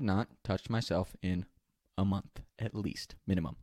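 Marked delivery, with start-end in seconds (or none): none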